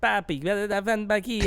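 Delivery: attempted Brazilian accent. Shown as a demonstration. Trying out the doctor's accent for friends, faked Brazilian. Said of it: "I don't know if it's a Brazilian accent".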